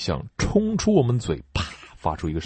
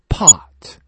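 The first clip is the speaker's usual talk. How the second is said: unvoiced breathy "Pot." with a puff; whispered drill word; 6 semitones higher, 9 dB louder than usual. Shown as a demonstration. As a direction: whisper "Pot." letting the air burst out.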